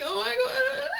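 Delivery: very high laughing cry; laughing exclamation. Retold or imitated. Driver hunting for parking, high laughing cry. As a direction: squeal it out through laughter.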